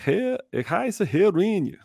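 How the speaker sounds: high, slow mock French accent; German hammed up with a French lilt, laughing; high-pitched playful French-accented German. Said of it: "French accent in German, for example".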